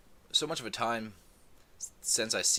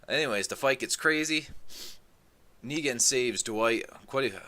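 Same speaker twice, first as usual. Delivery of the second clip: usual speaking voice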